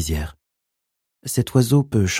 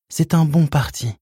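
pleading mother; 7 semitones higher than usual. Acted. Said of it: "Sa voix était implorante".

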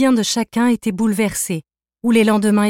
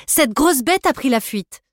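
raised, high call toward the pavilion; 7 semitones higher than usual. Acted. Calls woman out of pavilion.

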